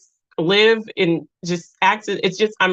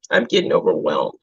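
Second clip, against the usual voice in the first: much lower, voice sinking with feeling; saying she's overwhelmed as she chokes up on stream, much lower; overwhelmed with emotion. Real, not acted. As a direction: let the emotion pull the voice down.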